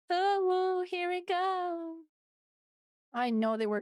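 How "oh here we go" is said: high, drawn-out cutesy imitation. Streamer mimicking cutesy stuff for co-hosts, high cutesy voice. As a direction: put on a higher, cutesy streamer voice. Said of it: "Any cutesy stuff like".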